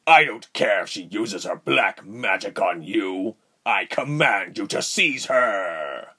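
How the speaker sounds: commanding, drawn-out villain cry; enraged villain. Acted. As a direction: order the soldiers in fury. Voices enraged villain commanding soldiers.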